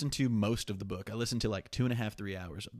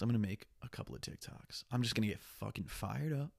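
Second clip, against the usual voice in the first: ordinary talk, nothing marked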